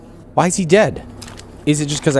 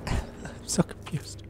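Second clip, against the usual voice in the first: laughing softly; 11 dB quieter, much slower than usual. Real, not acted. Laughs through confusion.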